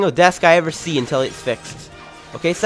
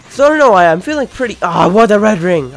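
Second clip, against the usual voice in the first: excited; 5 semitones higher, 8 dB louder than usual. Real, not acted. Excited outburst.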